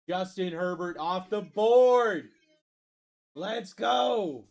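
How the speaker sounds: loud call with long drawn-out last words; hype announcer call. Real, not acted. Host calling a pick to the stream, hyped announcer shout. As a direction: announce it big, stretching the final word.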